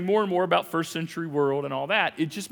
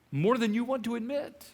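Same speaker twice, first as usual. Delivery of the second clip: normal talking voice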